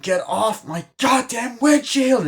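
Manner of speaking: high, loud, drawn-out shout; hammed-up screamed order; mock furious yell. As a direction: imitate an enraged driver yelling. Acting out driver yelling.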